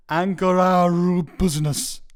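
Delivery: slow, drawn-out attempt at a Welsh accent; lightly hammed Welsh accent, stretching Angora. Reading a Welsh listener's review in a light Welsh accent. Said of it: "that was my Welsh accent".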